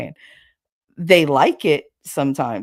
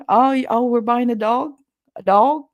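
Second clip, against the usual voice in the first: her own accent on "dog"; her drawled dog; 7 semitones higher, 5 dB louder than usual. Shown as a demonstration. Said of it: "I still say it like that".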